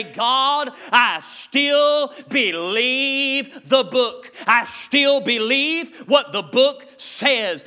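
drawn-out prayer. Acted. Preacher voicing the faithful, slow and fervent. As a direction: testify slowly, each word held. Voices believers' defiant prayer.

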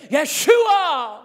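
Yeshua shouted high and loud in scorn; a jeering mob yelling Yeshua at fleeing David; mocking yell. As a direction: hurl the name like a taunting crowd. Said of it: "they're screaming".

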